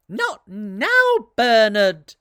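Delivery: high, loud brush-off from mum; mother ignoring Bernard, high and loud; dismissive mother. Acted.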